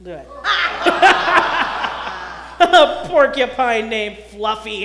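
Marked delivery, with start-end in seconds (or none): 0.3-2.5 a big, loud, high roaring laugh. Shown as a demonstration. Leading the children to roar with laughter, loud high laugh. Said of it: "Roar for laughter. Do it". 2.6-4.8 loud, slow, laughing the words out; howling laughter. A narrator's reading. Rhinoceros mocking Fluffy's name, loud laughing howl. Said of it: "howled the rhinoceros".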